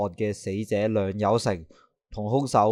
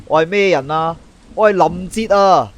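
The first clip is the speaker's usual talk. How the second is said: louder and higher, asserting his name; proud declaration; 7 semitones higher, 6 dB louder than usual. Acted. Detective rallying himself alone, loud proud declaration. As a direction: pump yourself up, declare it.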